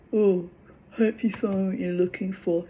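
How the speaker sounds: sarcastic parody of a brush-off; mocking sing-song. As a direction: sneer it back in a sweet fake tone. Mocking men's dismissive line.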